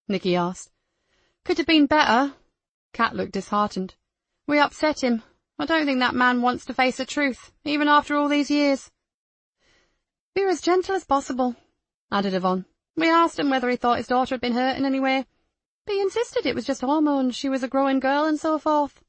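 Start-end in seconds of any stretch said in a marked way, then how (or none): 1.4-2.4 female character voice. Acted. Kat disheartened reply. 4.5-8.9 female character voice. Acted. Kat reports visit. 10.3-11.6 female character voice. Acted. Yvonne's voice. 12.9-19.0 female character voice. Acted. Kat reports visit.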